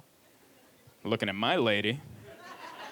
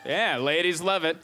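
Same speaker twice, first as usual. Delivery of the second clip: normal talking voice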